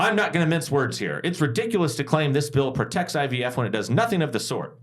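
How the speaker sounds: high, angry indignant senator; indignant Karen senator voice. Retold or imitated. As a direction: play an outraged Karen senator at the podium. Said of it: "my best, uh, angry, indignant Karen senator voice".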